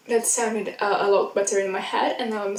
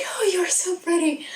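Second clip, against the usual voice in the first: gushing Barbie; 8 semitones higher than usual. Retold or imitated. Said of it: "they were like".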